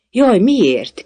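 high, loud exclamation; startled question. A narrator's reading. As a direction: ask in alarmed surprise. Alice reacts to death sentence.

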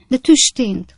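high, loud, urgent order; sharp command. A narrator's reading. Lady demands Pickwick leave her room, sharp command.

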